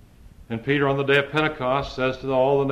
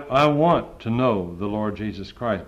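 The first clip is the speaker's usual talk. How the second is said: ordinary delivery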